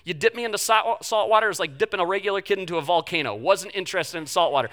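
ordinary delivery

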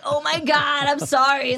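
loud, high, acted-out apology; retold panic at a social blunder; mortified apology. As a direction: blurt a hammed-up apology, embarrassed.

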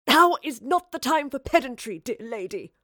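high, loud, urgent gasp. Acted. Marlowe cuts off the professor's pedantry, gasping. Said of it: "Marlowe gasped".

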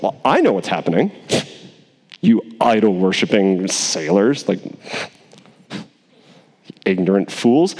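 a hint of lofty scorn for the sailors; mildly smug, looking down on them; smug Jonah. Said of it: "He's almost smug to the sailors".

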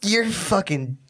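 high, slow threatening mom voice; imitating angry mom. Mom on speaker threatening her son, high and angry. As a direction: lay down the punishment like a furious mom.

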